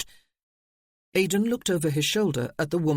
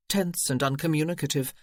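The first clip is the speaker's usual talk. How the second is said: plain reading voice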